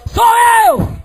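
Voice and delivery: very high, held shout; full-voiced yell. Returning son calling out from the gate, sustained high yell. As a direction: hurl the words far across the field.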